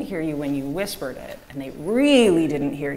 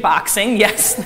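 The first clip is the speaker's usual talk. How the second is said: delighted agreement breaking into laughter; amused laughing; 3 semitones higher, 7 dB louder, slower than usual. Real, not acted. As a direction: laugh through it, loud. Laughs at child's boxing answer.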